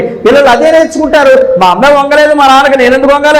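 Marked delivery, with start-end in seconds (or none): 1.6-3.4 put-on high, fast defiant kid; lightly voiced defiant kid; defiant child. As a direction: sass back like a stubborn child.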